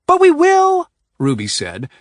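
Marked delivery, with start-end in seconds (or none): 0.1-0.9 very high, loud cry; screaming. A narrator's reading. Ruby screaming at Adrian, worked into a tizzy. She was still screaming.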